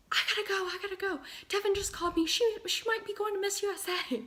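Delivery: high, loud, fast excited scream; screaming excitedly. Retold or imitated. Mom leaving work, screaming the news through Macy's. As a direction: yell it as you run out of the store. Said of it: "She's leaving screaming".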